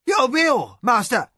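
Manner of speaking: high, loud servant's cry; a startled cook crying out high and loud; cried out. A narrator's reading. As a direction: cry out in alarm to your master. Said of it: "cried the head cook".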